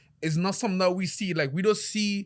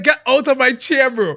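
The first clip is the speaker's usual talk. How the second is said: much louder, higher yell; outraged shout; 10 semitones higher, 12 dB louder than usual. Retold or imitated. Barber ordering a client out of his chair, outraged shout. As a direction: throw him out at the top of your voice.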